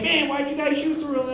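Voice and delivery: high-pitched whiny hunter's gripe; mock complaint. Acts out complaining duck hunter.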